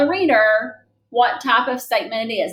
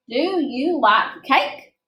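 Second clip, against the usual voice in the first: slow question reading; 3 semitones higher, much slower than usual. Shown as a demonstration. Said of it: "That's a question mark".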